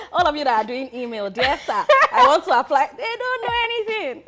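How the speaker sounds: laughing. Real, not acted. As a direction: tease them, breaking up with laughter. Laughing at job-seekers emailing applications.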